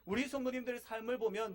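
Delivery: normal talking voice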